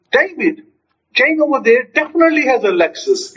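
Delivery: high-pitched, emphatic announcement; excited. Acted. Telling David about Jane's car, excited. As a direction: make it thrilling news. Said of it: "see how the statement changes".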